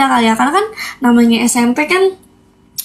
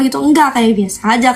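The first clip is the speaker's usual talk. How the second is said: ordinary delivery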